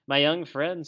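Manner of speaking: loud, stern form of address; great severity. A narrator's reading. Badger reproving young Toad, great severity. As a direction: pull him up sternly. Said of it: "said the Badger with great severity".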